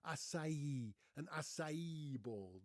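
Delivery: "Acai" as ah-sah-EE, ending stressed; "acai" in three syllables, ah-sah-EE; final-syllable stress. Shown as a demonstration. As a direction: demonstrate "acai" with an s and a ringing final EE. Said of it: "with the stress on the last syllable".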